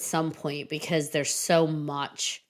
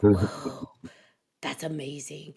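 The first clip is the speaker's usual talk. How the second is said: awed whisper; 3 semitones lower, slower than usual. Retold or imitated. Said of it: "And it's like".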